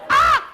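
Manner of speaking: very high, loud short shriek; child's scream. Retold or imitated. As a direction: imitate a kid shrieking like a crow. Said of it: "Why are we screaming?".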